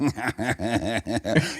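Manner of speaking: soft, rapid mimicked snicker; imitated giggle. Same man beside the heckler, soft imitated giggling. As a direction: snicker softly like the sidekick. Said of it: "He like".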